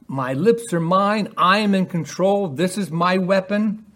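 boastful wicked. Acted. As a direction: gloat over your own power.

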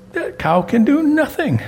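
put-on high child voice with a laugh; a giggling five-year-old's verdict; laughing little girl. Grandpa voicing granddaughter, high and laughing, dismissing the idol.

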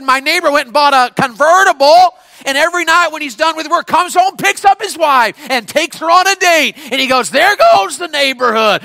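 loud, high, shouted sermon; full-force preaching. Real, not acted. Preacher declaring the cross can change everything, full-force preaching. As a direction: belt out the verses to the crowd.

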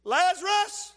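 Lazarus called out high and loud; loud cry. Acted. Jesus calling Lazarus from the grave, loud cry. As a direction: call him out of the tomb. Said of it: "he cried with a loud voice".

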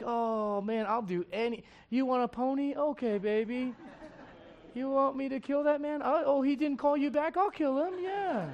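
high, coddling dad voice soothing a crying daughter; father of girls soothing his crying daughter, high doting voice. Retold or imitated. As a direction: coo like a dad who'd promise anything. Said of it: "when girls cry, it's just like".

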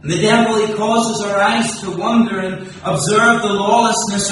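loud, high, ringing preacher's build to a climax; impassioned pulpit proclamation, every line driven hard; full-force preaching. Acted. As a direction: preach it out to the whole hall.

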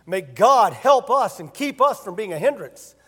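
ordinary talk

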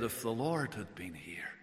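breathy whisper of the sisters' words; whispered grief. Acted. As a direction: hush it to a whisper. Sisters say it together.

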